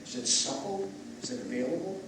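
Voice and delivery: soft, calm breathwork guidance; guided breathing. Acted. Leads a breathing exercise.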